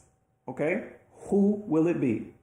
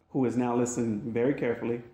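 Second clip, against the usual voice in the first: ordinary delivery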